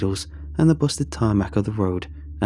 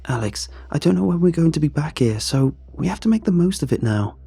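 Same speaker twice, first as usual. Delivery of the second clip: lightly voiced friend, pitched up; insistent friend; 7 semitones higher than usual. Friend urging Alex to keep searching, mildly insistent. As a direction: urge the driver on in a higher voice.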